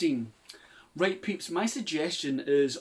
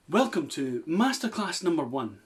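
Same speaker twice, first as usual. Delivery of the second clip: ordinary talk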